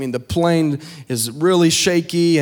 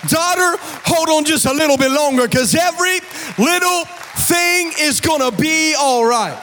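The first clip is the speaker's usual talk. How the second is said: higher, sung-out preaching over music; full-force preaching; 8 semitones higher than usual. Acted. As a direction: lift the church, chant it like a climax.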